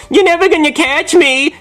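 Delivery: put-on high, taunting kid's yell. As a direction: tease like a giggling kid. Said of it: "he yells".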